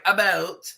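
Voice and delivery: "About" in a mock accent, vowel shifted toward "a-boat"; imitating Trina's pronunciation. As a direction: say "About" as "a-boat", mimicking her. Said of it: "when Trina says the word about, she's like".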